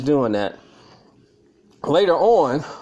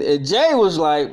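ordinary delivery

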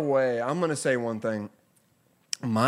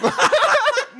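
high cracking laugh; 7 dB louder than usual. Real, not acted. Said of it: "there's another voice crack".

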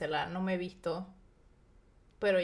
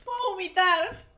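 high, drawn-out half-whimper; whimpering nausea; 13 semitones higher, slower than usual. Real, not acted. Queasy friend whimpering during a drinking game, high whine. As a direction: whine it out like you're about to be sick.

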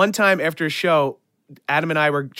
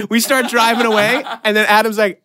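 usual speaking voice

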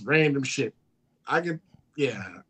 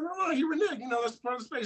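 insistent protest; 9 semitones higher, 6 dB quieter, faster than usual. Retold or imitated. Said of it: "I was like".

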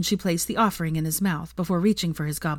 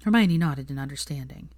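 usual speaking voice

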